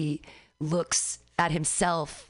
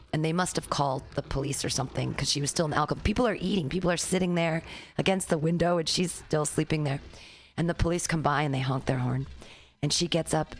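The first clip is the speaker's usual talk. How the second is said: ordinary talk, nothing marked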